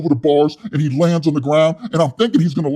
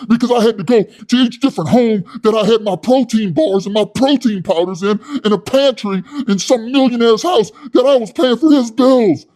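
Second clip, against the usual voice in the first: high, loud, puffed-up yelling; loud hothead Wes; 7 semitones higher, 5 dB louder than usual. Retold or imitated. Wes scolding his soy boy cellmate, loud and high. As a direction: rant like a tough guy with something to prove. Wes bragging about houses.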